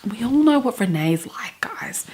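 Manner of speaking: a hushed conspiratorial whisper; whispered aside. Real, not acted. Vlogger confiding to viewers about Renee, whispered aside.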